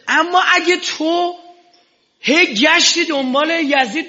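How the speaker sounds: high, loud pulpit preaching, pressing on; full-force mourning sermon; fiery preaching. Acted. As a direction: drive the congregation hard from the pulpit.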